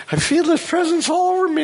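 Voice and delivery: a high, awed quote of the woman. Retold or imitated. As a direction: voice jumps high with awe. Quoting a woman in awe.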